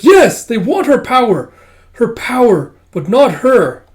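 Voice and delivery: high, loud, desperate accusation; impassioned shouting. Acted. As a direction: cry out every phrase at full voice. Kippa accuses the courts, distraught.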